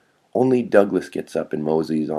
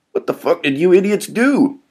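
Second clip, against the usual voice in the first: exasperated demand; 11 semitones higher, 4 dB louder than usual. Acted. Hurt Bill demands an explanation.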